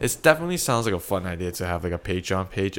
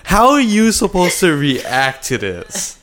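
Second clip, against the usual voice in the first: excited; 3 semitones higher, 8 dB louder than usual. Acted. Animated hypothetical story.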